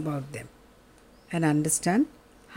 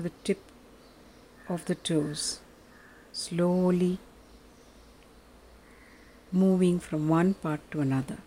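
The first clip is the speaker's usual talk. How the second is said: soft, lower, unhurried meditation voice; guided meditation; 4 semitones lower, 5 dB quieter than usual. Acted. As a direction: guide them gently into stillness.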